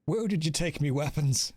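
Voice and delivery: put-on not very good Irish accent; amateur Irish character voice. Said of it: "We're gonna go with Irish for this one".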